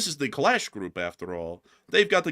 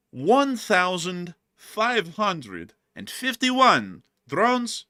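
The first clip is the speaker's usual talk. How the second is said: slow, raised and drawn-out number; big slow reveal, pitched up; dramatic announcement; 6 semitones higher, 4 dB louder, much slower than usual. Real, not acted. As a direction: announce the record figure very slowly for effect. Announcing a record drone loss figure.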